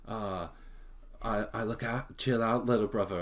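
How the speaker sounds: mocking a fake English accent, lightly; a put-on, overdone English accent, only half committed; bad English accent. Imitating the brother's bad English accent, a light mock English accent. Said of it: "no one in England has that voice or that accent".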